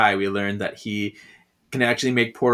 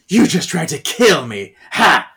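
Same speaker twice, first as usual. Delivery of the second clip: louder, higher accusation with a barked laugh; mock accusing outburst; 5 semitones higher, 6 dB louder than usual. Host voicing Prue confronting Phoebe, loud accusation. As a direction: throw it out loud, ending on a sharp "ha".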